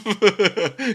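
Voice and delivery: loud, high laugh; burst of laughter. Real, not acted. Consoling someone over a lost parent, a loud rueful laugh. Laughing amid talk of loss.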